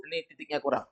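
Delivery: ordinary delivery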